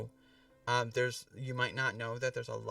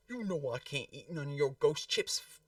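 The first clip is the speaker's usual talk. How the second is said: put-on New Zealand accent; 4 semitones higher, 3 dB louder than usual. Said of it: "very typical New Zealand accent".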